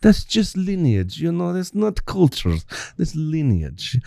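put-on playful mixed Russian-Arabic accent; fake Russian-Arabic accent. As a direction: keep the made-up Russian-Arabic accent going. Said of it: "I hate everything about it".

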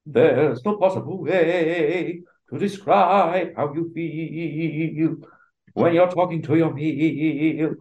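sing-speak it like a Broadway star; Hugh Jackman impression. Jackman as the wolf, slow hammed-up belt. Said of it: "Hugh Jackman".

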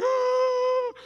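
hammed-up kid's crying wail; mock ugly cry. Hurt kid walking to dad, hammed-up ugly-cry wail. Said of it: "I'm crying like".

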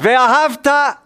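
high, drawn-out ringing call; with gusto. Shown as a demonstration. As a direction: lead the congregation in a full-voiced prayer call. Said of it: "say it with some gusto".

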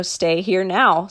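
ordinary delivery

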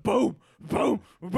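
loud booming mock chant; imitating booming thuds; mimicked thrusting booms. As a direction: boom it out like the dad. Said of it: "he's going like".

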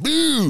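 loud high boom; impact boom. Retold or imitated. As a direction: punch out the slam. Slamming him down.

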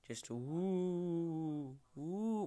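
a mock ghostly oooh, rising high; spooky sounds. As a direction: play at being a ghost, lightly. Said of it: "Why am I doing spooky sounds?".

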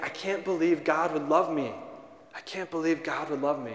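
softly retold tearful disbelief; low, subdued, imitating a man in tears; retold crying. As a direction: quote his despair softly, without sobbing. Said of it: "he's just crying".

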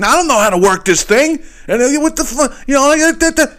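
high-pitched, frantic mock yell; mimicked yelling. Son yelling at his mother about the register, high mock yell. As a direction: mimic a worked-up yell breaking into nonsense. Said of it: "He's still yelling at her".

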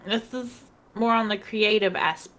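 ordinary delivery

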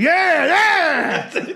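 high, loud whoops of delight; hammed-up cries from a man having a great time; ecstatic yelling. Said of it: "he's having a great time".

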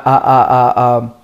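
normal talking voice

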